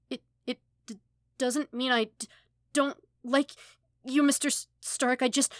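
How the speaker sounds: high, loud tearful stammer; crying. Acted. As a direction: sob the apology out, stumbling over every word. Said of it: "hiccuping violently now, shaking from both his sobs".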